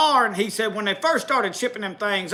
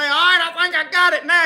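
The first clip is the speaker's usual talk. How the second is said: pitched-up mock Ralph, pleased with himself; a lightly voiced Ralph sure he's got the gear; high, hammed-up buddy voice; imitating Ralph; 7 semitones higher than usual. Ralph voiced in retold story.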